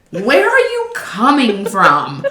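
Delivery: slow, stretched question with a big pitch swoop; puzzled missionary. Retold or imitated. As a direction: imitate baffled asking, drawing out each word. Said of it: "He's like".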